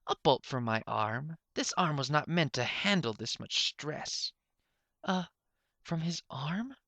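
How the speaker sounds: fretful inventor hanging on; lightly voiced anxious professor. Dangling unicorn explains his arm to Suture, lightly worried.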